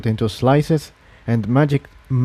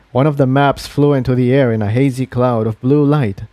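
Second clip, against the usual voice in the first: ordinary delivery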